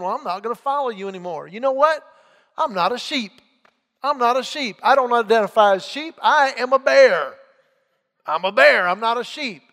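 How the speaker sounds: rising into a loud, defiant declaration; defiant sheep. Acted. Defiant sheep voiced in parable.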